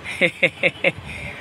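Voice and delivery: short, breathy chuckle; a quick little "hehe" laugh. Real, not acted. Parent amused by the children's quiz answers, chuckling.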